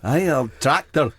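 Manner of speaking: lightly voiced rural Somerset accent; a hint of West Country farmer. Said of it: "can you give us a s".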